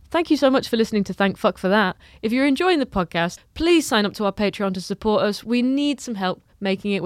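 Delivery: projected, friendly plea for sign-ups; podcast promo read. Real, not acted. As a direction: address the listeners in a projected promo voice. Recorded appeal to listeners.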